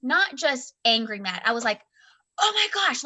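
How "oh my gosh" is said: higher, mild mock yell; retold grumpy yelling. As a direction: snap it as a retold outburst. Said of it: "like, yelling at him".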